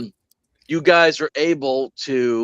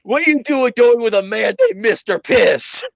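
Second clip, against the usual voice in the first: character voice; 7 semitones higher, 4 dB louder than usual. Retold or imitated. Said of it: "quoted character".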